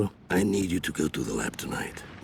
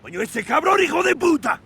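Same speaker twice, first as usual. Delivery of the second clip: high, loud Spanish cursing outburst; furious shout; 7 dB louder than usual. Acted. Boss hearing bad news on the phone, furious.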